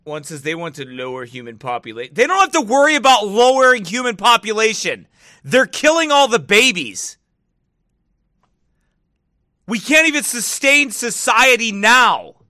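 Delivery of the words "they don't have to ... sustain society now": high, loud exasperated rant. Real, not acted. Answering claim about lowering population, loud rant. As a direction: holler it in protest. Ranting back at a commenter's claim.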